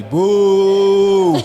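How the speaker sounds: playful boo. Real, not acted. Booing rival team.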